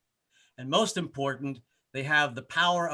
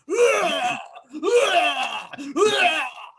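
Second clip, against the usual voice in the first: high shrieking laugh; 13 semitones higher, 6 dB louder than usual. Real, not acted. Comic cracking himself up with a high shrieking laugh.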